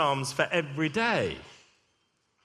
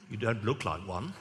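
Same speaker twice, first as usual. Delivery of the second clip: lower, softer royal voice; a hint of Charles, lower and hushed; Prince Charles impression; 7 semitones lower, 6 dB quieter than usual. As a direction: murmur like a polite royal. Vicar imitates Prince Charles speaking.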